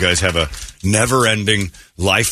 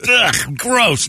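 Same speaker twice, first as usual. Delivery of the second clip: annoyed ugh; 13 semitones higher than usual. Pretend. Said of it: "Just go".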